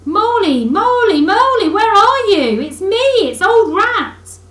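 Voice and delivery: loud, high, cheerful call into the woods. A narrator's reading. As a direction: call out for a lost friend, loudly and gladly. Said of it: "calling out cheerfully".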